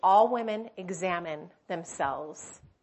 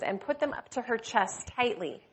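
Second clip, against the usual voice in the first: ordinary delivery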